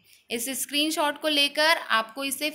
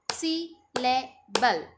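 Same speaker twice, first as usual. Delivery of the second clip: Syllable in three slow, separated beats; three-beat syllables; much slower than usual. Shown as a demonstration. Teacher clapping out syllables, syllable split in three. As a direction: pause after each syllable of syllable. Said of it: "three times clap करेंगे".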